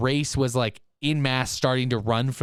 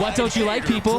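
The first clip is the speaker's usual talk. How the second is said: raised, fired-up call to the crowd; excited taunt; 7 semitones higher than usual. Acted. Host calling out haters, fired-up and raised. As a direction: challenge the haters, all pumped up. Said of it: "Haters, guess what?".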